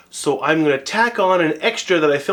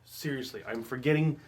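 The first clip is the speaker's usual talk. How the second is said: quiet voice; 12 dB quieter than usual. Real, not acted. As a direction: talk softly so nobody wakes. Whispered extra clip.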